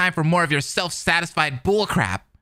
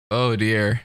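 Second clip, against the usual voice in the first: ordinary delivery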